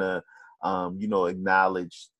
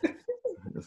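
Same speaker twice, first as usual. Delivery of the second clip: high laugh; 11 dB quieter than usual. Real, not acted. Laughing at rant joke.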